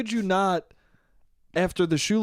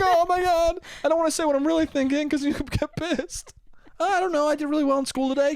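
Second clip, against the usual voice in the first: put-on much higher, anxious kid voice; mock nervous kid; 11 semitones higher than usual. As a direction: play a scared boy dodging the truth. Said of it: "he's like".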